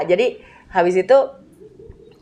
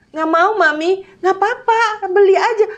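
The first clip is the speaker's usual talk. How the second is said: put-on mother and child arguing at the shop door, high-pitched; child refusing, mother insisting; 12 semitones higher than usual. Mother and child tugging, retold.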